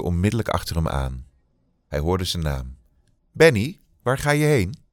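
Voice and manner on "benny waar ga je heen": higher, louder call after him; calling Benny's name across the set. Acted. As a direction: shout after someone running off. Crows call after fleeing Benny.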